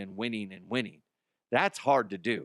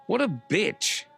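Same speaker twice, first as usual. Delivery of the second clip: high-pitched heckle from the back; jeering shout, pitched up; 8 semitones higher, slower than usual. Pretend. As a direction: call it out like a background heckler. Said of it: "you just shout out from the".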